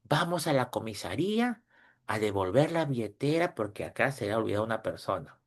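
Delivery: retold hushed request to a father; low voice. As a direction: say it hushed so nobody hears. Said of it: "en voz baja".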